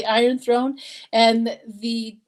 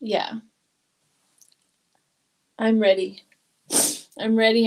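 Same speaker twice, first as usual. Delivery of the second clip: ordinary talk, nothing marked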